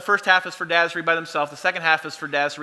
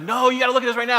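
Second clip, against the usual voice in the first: put-on insistent kid; 6 semitones higher, faster than usual. Son insisting to dad.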